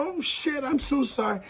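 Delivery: imitating someone suddenly horrified and sorry; high-pitched acted "oops, so sorry"; mock panicked apology. Acted apology after breaking nose.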